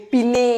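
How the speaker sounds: পিনে as two syllables, 'pi-ney'; mistaken pronunciation demo. Shown as a demonstration. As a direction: say পিনে loudly with the final e sounded. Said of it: "এরকম উচ্চারণ করছি?".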